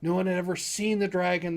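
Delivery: usual speaking voice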